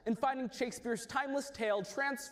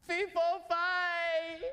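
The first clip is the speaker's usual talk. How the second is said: ranting cry; 9 semitones higher, much slower than usual. Acted. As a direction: rant like a giant. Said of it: "He cries".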